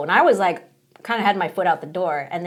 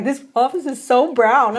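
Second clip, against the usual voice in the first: drawn-out, higher mock complaint; imitating coworkers' complaint; 4 semitones higher, slower than usual. Coworkers griping about the office, mock complaint. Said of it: "you guys are like".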